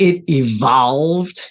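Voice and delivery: "evolved" stretched out slowly for emphasis; stress on evolved. Real, not acted. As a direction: lean hard and long on "evolved". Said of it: "I emphasize the word evolve".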